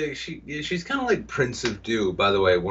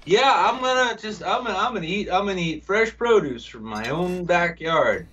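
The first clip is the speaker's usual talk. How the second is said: high-pitched, hammed-up YouTuber voice; mock channel host; 8 semitones higher than usual. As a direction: pitch it way up as a parody vlogger. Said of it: "in which it's like".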